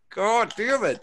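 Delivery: hamming up the frustration of being beaten; mock exasperation. Imagined conceding to rival.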